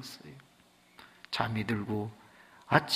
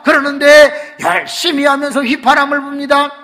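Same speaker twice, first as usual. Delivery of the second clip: much higher, loud preaching at full force; fervent preaching; 12 semitones higher, 17 dB louder than usual. Acted. Preacher tells the church the happy ending, fervently. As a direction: drive the story home to the congregation.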